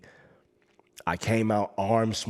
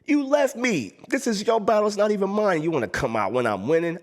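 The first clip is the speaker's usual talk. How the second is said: high, indignant reproach; angry; 11 semitones higher, 4 dB louder than usual. Retold or imitated. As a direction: throw the betrayal back at him. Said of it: "I was so angry".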